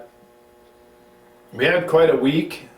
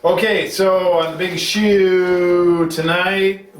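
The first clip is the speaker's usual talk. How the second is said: announcer voice; 5 semitones higher, 3 dB louder, slower than usual. Acted. Host launching the show.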